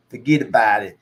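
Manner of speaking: put-on loud New York accent on request; punchy, put-on New York accent. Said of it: "in one New York accent".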